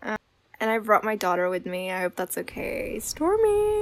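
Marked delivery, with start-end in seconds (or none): 3.0-3.8 put-on tiny, high child voice; little girl voice. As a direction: shyly say the name as a small girl. Daughter says her own name.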